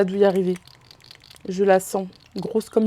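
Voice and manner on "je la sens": ordinary talk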